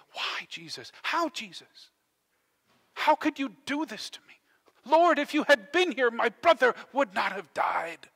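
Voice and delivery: mild reproach building to a high, loud cry; acted grieving accusation, growing stronger; angry, frustrated plea. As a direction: start held back, then cry out in hurt. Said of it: "maybe you've been angry and frustrated".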